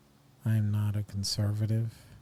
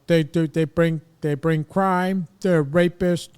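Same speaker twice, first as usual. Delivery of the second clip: mock ranting politician; 5 semitones higher, 6 dB louder than usual. Mocking anti-immigrant rant voice.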